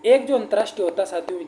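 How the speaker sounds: usual speaking voice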